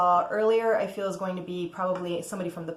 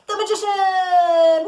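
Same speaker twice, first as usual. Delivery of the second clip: big drawn-out announcement, higher and louder; dramatic reveal; 11 semitones higher, 9 dB louder, slower than usual. Real, not acted. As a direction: unveil the card with a flourish. Dramatic reveal of tarot card.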